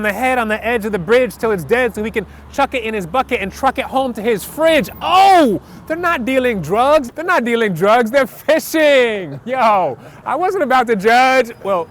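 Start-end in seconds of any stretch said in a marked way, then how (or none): 5.0-7.1 high, loud "oh" as it dawns; big drawn-out oh of discovery; sudden realization. Acted. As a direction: let the penny drop out loud.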